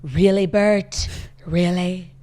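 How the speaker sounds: slow, drawn-out British "Really, Bert?"; British accent, slow and amused; slow British-accent quote. Retold or imitated. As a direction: savour the child's posh line slowly. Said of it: "start to talk like your kids".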